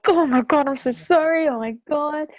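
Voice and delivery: loud and high, shocked; shocked outburst. Retold or imitated. Hairdresser learns of a client's death, loud shocked apology. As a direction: blurt it out, horrified.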